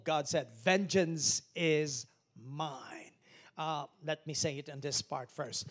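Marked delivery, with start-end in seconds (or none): 0.6-3.1 slow, loud, heavy Vengeance is mine; slow solemn quote. Acted. Pastor quoting God to the church, slow and loud. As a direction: intone it slowly.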